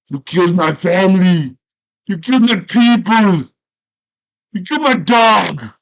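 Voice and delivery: high, loud furious shouts; furious accusation. Retold or imitated. Conan shouting at Darth Vader who killed his family, furious. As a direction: hurl each line as an accusation.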